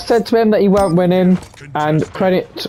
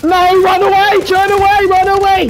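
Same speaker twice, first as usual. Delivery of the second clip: very high, loud squealing run away; mock panic squeal; 5 dB louder than usual. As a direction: squeal it like a frightened kid. Panicked cries while fleeing.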